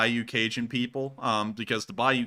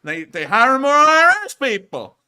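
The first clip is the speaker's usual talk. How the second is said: put-on Southern accent building to a rant; 7 dB louder than usual. Ranting about the federal government in a Southern accent. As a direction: get worked up about the IRS, Southern style. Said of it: "legible through that accent".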